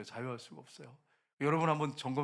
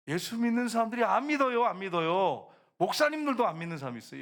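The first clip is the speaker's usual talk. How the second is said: loud, higher-pitched rebuke to the congregation; raised preaching voice; 5 semitones higher, 5 dB louder than usual. Real, not acted. Preacher confronting churchgoers about unbelief, loud and high. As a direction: raise your voice to rouse the listeners. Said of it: "소리를 좀 크게 지르는 것은".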